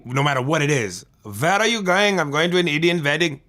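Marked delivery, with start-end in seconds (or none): 1.2-3.4 put-on singsong Indian accent. Shown as a demonstration. Comic showing off his impression, in an Indian accent. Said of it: "easiest ones for me to do is the Indian accent".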